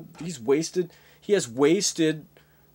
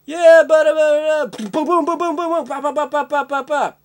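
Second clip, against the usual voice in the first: high, loud vocal booms and bops; mock gunfire noises; 9 semitones higher, 7 dB louder than usual. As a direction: spit out action-movie sound effects. Imitating explosions and gunfire.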